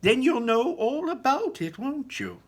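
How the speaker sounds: high, loud sly showman; Mister Wonka teasing. A narrator's reading. Wonka needling the geography teacher, high teasing voice. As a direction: tease her in a high bright showman voice. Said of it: "said Mister Wonka".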